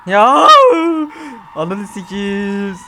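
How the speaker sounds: loud high yelp then slow drawn curse; startled scream; jumpscare scream. Real, not acted. Startled player screams at a jumpscare.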